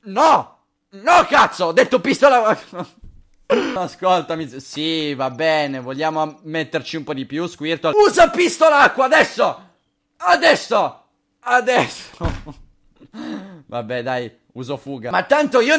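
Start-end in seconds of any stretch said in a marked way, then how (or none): 0.0-2.6 loud, high protest; mock angry scolding. Trainer scolding Squirtle for disobeying, mock angry. 7.9-12.6 high, urgent battle order, stretched at the end; shouted command. Pretend. Trainer ordering Squirtle to attack, shouted command.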